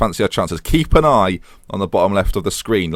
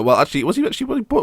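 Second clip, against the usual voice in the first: usual speaking voice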